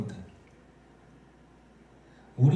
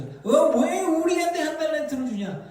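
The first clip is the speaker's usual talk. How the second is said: high-pitched indignant protest; acted aggrieved labourer; grumbling workers; 11 semitones higher, 4 dB louder than usual. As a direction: complain loudly about unfair pay, voice way up.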